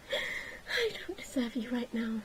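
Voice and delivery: slow soft whisper; whispered vow. Acted. Catherine quietly vows to Gilly to earn him, whispered. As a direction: whisper it as a promise.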